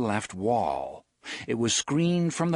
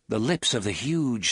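plain reading voice

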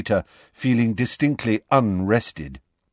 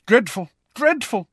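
high, self-important disapproval; pompous schoolboy tutting in a high voice; 12 semitones higher than usual. A narrator's reading. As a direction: deplore it pompously. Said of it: "shaking his head pompously".

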